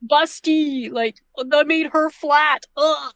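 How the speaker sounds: high, slow, drawn-out mock whining; hammed-up outrage, pitched well up; complaining gamers. Panelist imitates gamers griping about a redesign, hammed-up high complaint. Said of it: "many games where people will complain".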